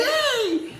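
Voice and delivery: very high, loud laughing "Hey!"; a burst of shocked laughter; shocked yelp. Retold or imitated. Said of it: "Because I'm shocked by the whole situation".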